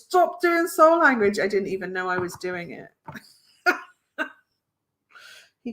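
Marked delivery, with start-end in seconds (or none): 0.0-1.4 imitating a cross husband. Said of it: "he told me off because he goes".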